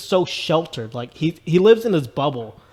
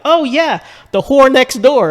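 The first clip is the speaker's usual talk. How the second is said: much higher, louder and slow, half laughing; Jimmy's line; 10 semitones higher, 11 dB louder, slower than usual. Retold or imitated. Retelling the movie scene, naive boy answers loudly. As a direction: ham up the naive boy's awkward line. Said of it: "And the way he said".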